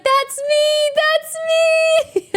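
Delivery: high, cracking, near tears; that's me, through happy tears; tearful joy. Retold or imitated. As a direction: relive the moment, choked up with pride. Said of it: "And I was like".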